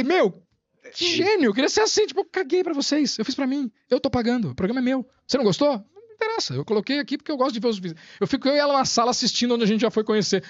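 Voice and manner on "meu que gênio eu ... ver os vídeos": bem mais agudo, empolgado e eufórico. Retold or imitated. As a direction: como quem acabou de ver um gênio, vibrando. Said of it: "Pô, eu falei". (admirado e eufórico, recontando a própria reação, bem mais agudo)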